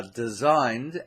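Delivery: designed drawn out and stressed; emphasis on designed. Real, not acted. Preacher teaching new worship leaders, stressing designed. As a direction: lean slowly on the word designed. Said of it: "emphasize that word designed".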